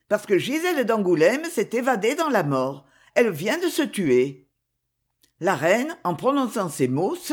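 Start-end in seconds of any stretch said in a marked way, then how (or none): none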